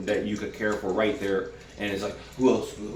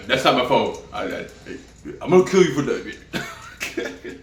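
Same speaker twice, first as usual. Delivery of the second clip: mock angry threat; 3 semitones higher, 4 dB louder than usual. Jock caught, threatening.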